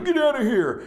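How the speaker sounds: high, forceful command; commanding the mountain. Retold or imitated. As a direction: order the mountain away.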